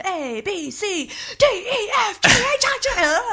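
manic alphabet recital climbing very high; Nicolas Cage. Acted. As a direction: recite letter by letter, pitch and force building wildly. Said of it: "beautifully recite the alphabet in the way that he does".

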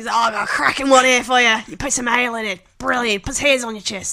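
put-on a light Australian accent, hearty bloke; chummy pub bloke in a mild Australian accent. Sharing a pie recipe with mates, mild Australian accent. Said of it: "So that's an Australian accent".

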